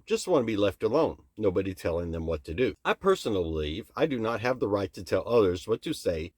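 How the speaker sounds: ordinary delivery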